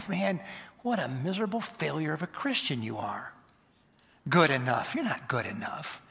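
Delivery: soft murmur in the ear; quietly insinuate, mimicking a tempter; imitated accusing whisper. Satan posing as God to a believer, half-whispered. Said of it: "Satan whispering in your ear".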